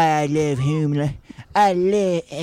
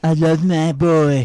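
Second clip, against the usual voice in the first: slow, drawn-out Trump voice; Donald Trump impression; 4 semitones higher, 5 dB louder, slower than usual. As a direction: draw out each word like Trump. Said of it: "that's a perfect Donald Trump".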